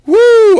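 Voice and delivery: excited high-pitched woo; long loud "woo"; loud high whoop. Real, not acted. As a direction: let out a big whoop. Hyped whoop at opening.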